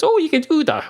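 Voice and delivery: high-pitched pleased surprise; pleasantly amazed, voice leaping up; delighted surprise. Acted. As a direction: react as if it's wonderful news. Dog delighted by Siri's offer.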